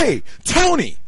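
loud call across the court. Retold or imitated. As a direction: yell his name to get attention. Coach calls out to referee.

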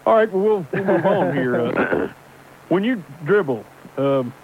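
lightly put-on folksy trucker voice; Microphone Johnson character. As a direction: play it as a gruff hardliner caller. Holds a put-on character throughout.

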